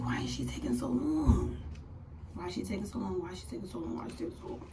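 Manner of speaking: anxious muttering that dies away; muttered inner thought. Retold or imitated. Patient waiting on a long sonogram, fading mutter. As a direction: repeat it, fading to very soft. Voices her repeated worried thought.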